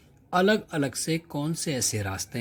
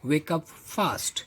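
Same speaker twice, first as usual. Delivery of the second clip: slow reading; 4 semitones higher, much slower than usual. Shown as a demonstration. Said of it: "जैसे कहते हैं".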